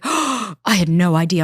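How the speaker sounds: slow, drawn-out mock surprise; stretched-out retold amazement; astonished realization. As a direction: imitate a friend's dawning oops. Friend's gasped realisation, acted.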